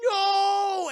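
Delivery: high, loud cry of no; anguished shout. Retold or imitated. Monster father screaming at his nest on fire. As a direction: shout it in horror. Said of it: "the male Mewtwo's like".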